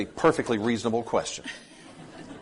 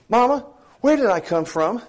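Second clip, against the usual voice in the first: put-on high, louder little-kid voice; little boy asking; 7 semitones higher, 4 dB louder than usual. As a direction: play the curious six-year-old. Little boy asks his mother.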